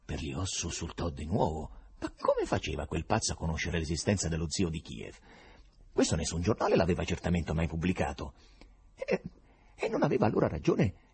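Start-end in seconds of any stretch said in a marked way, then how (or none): none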